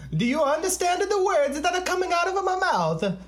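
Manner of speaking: high, loud movie-line impression; Rush Hour quote. Host quotes Chris Tucker's Rush Hour line, high loud impression. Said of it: "Rush Hour".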